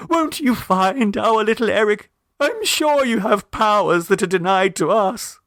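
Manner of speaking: high, eager pleading mother; a light hopeful beg in the mother's voice; hopeful plea. A narrator's reading. Mrs Mummerby pleads with Miss Gilpert, high and eager. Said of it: "struck by a happy inspiration".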